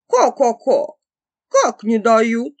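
loud, clucking rooster. A narrator's reading. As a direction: squawk it out like a cockerel.

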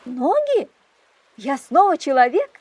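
high, loud, excited exclamations; surprised and thrilled at turning human; astonished joy. Acted. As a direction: gasp with delight at your own hands. Transforming back into human.

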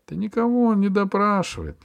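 usual speaking voice

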